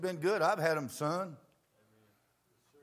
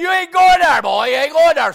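loud, high no from a father; shouted refusal; 12 semitones higher, 16 dB louder than usual. Shown as a demonstration. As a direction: bark the refusal at the boy. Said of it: "So you didn't say it like that".

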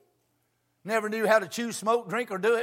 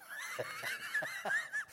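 sudden high laugh; high burst of laughter; 10 dB quieter than usual. Real, not acted. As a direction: laugh out at the memory. Laughing at the shouting wife.

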